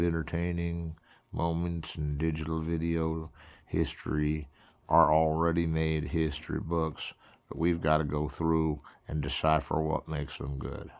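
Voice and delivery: ordinary delivery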